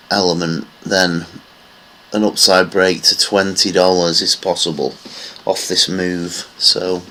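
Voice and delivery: ordinary delivery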